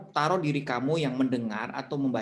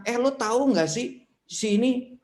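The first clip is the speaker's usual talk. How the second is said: curious question; 9 semitones higher, 3 dB louder than usual. Acted. Friend asks if you know someone.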